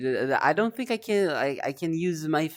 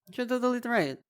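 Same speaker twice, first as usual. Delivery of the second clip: ordinary talk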